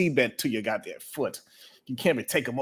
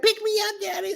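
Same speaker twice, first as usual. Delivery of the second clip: put-on much higher, childlike plea of a two-year-old; little daughter. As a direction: whine it like a toddler wanting to be carried. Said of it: "so she's like".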